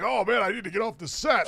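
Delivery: a hint of hammy panic; imitating Phil. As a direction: play a man caught on camera. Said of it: "And the Phil like".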